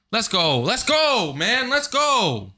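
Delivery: high excited cheering; celebrate, voice jumping up. Real, not acted. Celebrating clearing platforming.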